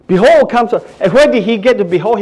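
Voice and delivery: ordinary talk, nothing marked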